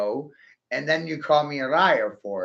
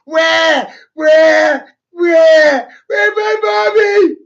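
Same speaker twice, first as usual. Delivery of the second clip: put-on high, loud whiny baby wail; mock crying baby; 13 dB louder, much slower than usual. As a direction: bawl like a baby, exaggerated and mocking. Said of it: "crying about".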